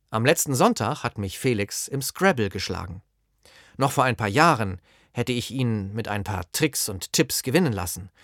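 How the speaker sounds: ordinary talk, nothing marked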